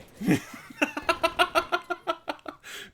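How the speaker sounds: long high breathless laugh; high laughing fit. Real, not acted. Still laughing at the community's prank, a long high laugh. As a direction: let a high laugh roll out.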